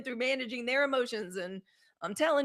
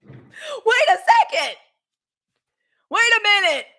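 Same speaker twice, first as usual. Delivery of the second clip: high, loud, slow drawn-out "Wait a second"; outraged shout; 11 semitones higher, 10 dB louder, slower than usual. Retold or imitated. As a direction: yell it slowly in mock outrage. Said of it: "I said".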